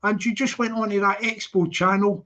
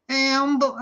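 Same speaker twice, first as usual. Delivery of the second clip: higher-pitched mock Scouse; Scouse accent; 5 semitones higher than usual. Streamer mimicking Expo calling to Humble, Scouse accent. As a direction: put on a Scouse call. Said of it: "that's it".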